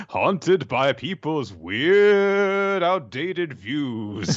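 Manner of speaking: spooky voice. Pretend. Spooky joke voice.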